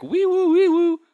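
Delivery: very high, loud two-note wail; imitated police siren. Storyteller imitating police arriving, high siren noise. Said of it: "the police are like".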